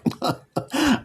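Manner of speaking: high, gleeful evil cackle; evil laugh. Acted. Devil cackling at the new arrival. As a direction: cackle with glee.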